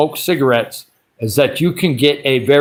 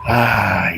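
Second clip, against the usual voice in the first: laughing gasp; 6 semitones lower than usual. Real, not acted. Laughing gasp after hot sauce.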